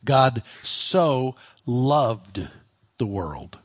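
deliberate verse reading, each word stressed; word-by-word emphasis. Shown as a demonstration. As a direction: weigh "so" and "loved" singly, pausing before "the world". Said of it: "put the emphasis of the verse".

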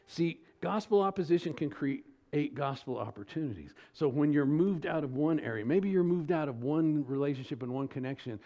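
ordinary delivery

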